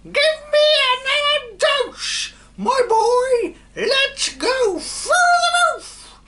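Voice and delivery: screeching hag, very high and loud; old hag screeching from above; old screechy voice. A narrator's reading. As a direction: shriek it like a gleeful witch. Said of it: "the old screechy voice".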